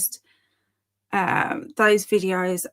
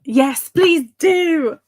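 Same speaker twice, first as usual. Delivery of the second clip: loud, high, drawn-out eagerness; thrilled, slow emphatic yes; excited pleading; 6 semitones higher, 7 dB louder, much slower than usual. Real, not acted. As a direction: beg them eagerly, stretching the words. Eagerly welcomes a viewer's offer.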